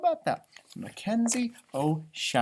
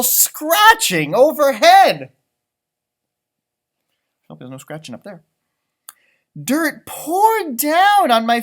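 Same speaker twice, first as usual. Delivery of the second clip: louder, rising leprechaun telling of danger; alarmed leprechaun; 5 semitones higher, 7 dB louder, slower than usual. Acted. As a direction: build the fright of the intrusion. Reads dramatic story part.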